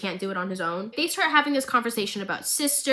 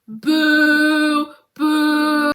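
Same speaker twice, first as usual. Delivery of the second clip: loud mock boo at the villain; 5 semitones higher, 7 dB louder than usual. Real, not acted. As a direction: jeer him loudly like a pantomime crowd. Booing Marcus's entrance.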